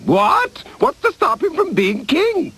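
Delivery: sharp high startled what; a king caught off guard by a sudden idea. Acted. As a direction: jolt up in alarm.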